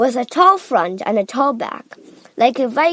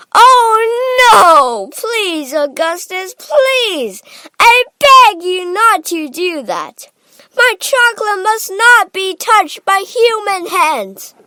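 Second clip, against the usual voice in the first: loud, high-pitched pleading; crying out; 9 semitones higher, 9 dB louder than usual. A narrator's reading. Wonka begging Augustus off the chocolate, crying out in alarm. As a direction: beg urgently at full voice. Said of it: "he cried out".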